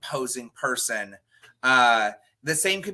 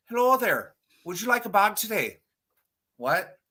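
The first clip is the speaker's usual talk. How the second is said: mock Irish accent; 6 semitones higher than usual. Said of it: "I would randomly go Irish".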